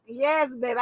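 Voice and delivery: ordinary delivery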